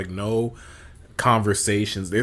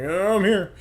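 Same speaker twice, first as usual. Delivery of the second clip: high, lightly acted arrival; lightly mocked belated arrival; latecomer catching up; 10 semitones higher, 4 dB louder than usual. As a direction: pipe up high as the straggler. Late face catches up in bit.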